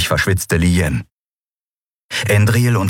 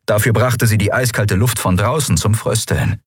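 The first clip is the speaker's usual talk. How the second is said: ordinary delivery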